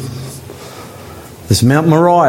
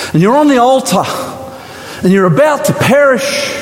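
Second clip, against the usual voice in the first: impassioned preaching; 6 semitones higher than usual. Acted. Preacher pleading with the congregation, fervent and high. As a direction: build the sermon to a passionate peak. Preacher builds to full force.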